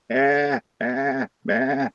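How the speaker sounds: soft imitation of a sheep; sheep bleat. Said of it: "he must have a sheep in there".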